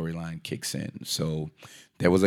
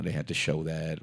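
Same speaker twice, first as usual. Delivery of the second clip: ordinary talk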